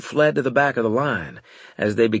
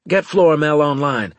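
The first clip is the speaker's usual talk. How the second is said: raised, commanding voice; urgent order; 5 semitones higher, 6 dB louder than usual. A narrator's reading. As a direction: give the order loud and high. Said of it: "I ordered".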